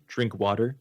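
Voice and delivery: ordinary delivery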